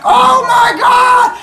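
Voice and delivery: very high, slow, loud scream of shock; the crowd shrieks in disbelief; shout. Retold or imitated. Crowd shrieking as Athena appears.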